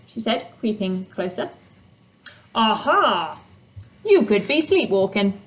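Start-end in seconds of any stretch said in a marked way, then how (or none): 2.5-5.4 a hint of a smug hidden creature; lightly voiced, self-satisfied gotcha; pleased with itself. A narrator's reading. Grumpy creature scoring a point off the girl, lightly smug. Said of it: "sounded rather pleased with itself".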